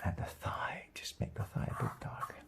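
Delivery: soft whisper to himself while darkening; whispered to self. Real, not acted. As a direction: keep it a breathy murmur, lost in the work. Painter murmuring while working.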